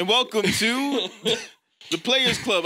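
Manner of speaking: slow, high host welcome; talk show host voice. Pretend. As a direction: welcome the studio audience slowly. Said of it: "talk show host".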